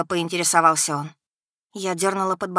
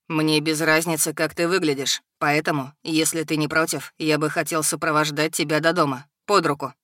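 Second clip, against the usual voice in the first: low menacing dragon; 4 semitones lower than usual. A narrator's reading.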